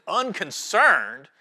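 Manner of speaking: ordinary talk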